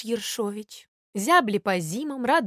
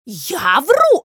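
loud, high indignant cry; incredulous angry shout; outraged yell; 5 semitones higher, 7 dB louder than usual. Acted. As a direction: throw the accusation back in a fury. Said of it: "орал Воробей Воробеич".

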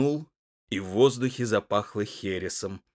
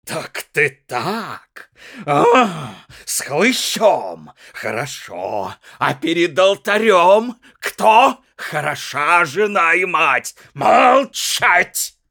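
higher, louder, mounting rage; a jealous husband working himself up; furious husband; 6 semitones higher, 7 dB louder, slower than usual. A narrator's reading. Муж в гневе на жену. (нарастающий яростный крик)

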